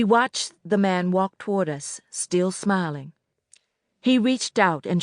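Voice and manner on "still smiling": plain reading voice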